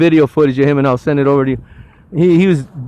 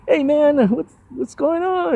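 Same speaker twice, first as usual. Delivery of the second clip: retold greeting; 11 semitones higher, 3 dB quieter, slower than usual. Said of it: "I said".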